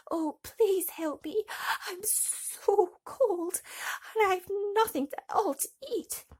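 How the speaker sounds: high, slow, pleading little voice; begging Thumbelina. A narrator's reading. Thumbelina begs the field mouse at her door, pleading.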